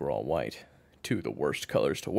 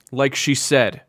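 loud, higher cut-in; quick interjection; 8 semitones higher, 13 dB louder, slower than usual. A narrator's reading. Kyler stops coach walking away, quick loud interjection. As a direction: cut in forcefully to stop him. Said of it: "Kyler said quickly".

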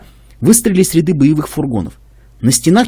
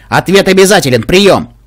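loud, higher, insistent officer; commanding voice; 4 semitones higher, 5 dB louder than usual. Acted. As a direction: bark a demand over the radio. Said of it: "Властным голосом". (властным, требовательным голосом)

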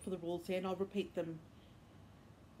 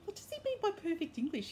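incredulous; 9 semitones higher than usual. Retold or imitated. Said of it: "And I've gone".

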